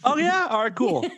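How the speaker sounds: high, laughing "oh yeah". Real, not acted. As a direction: toss it off through a laugh. Laughing reply.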